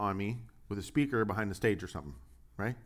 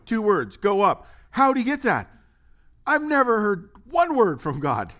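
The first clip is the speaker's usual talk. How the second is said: higher and louder, hammed-up bewilderment; mock astonished disbelief; 8 semitones higher, 8 dB louder than usual. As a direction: play the baffled skeptic, pitch up and push it. Mock disbelief over God speaking.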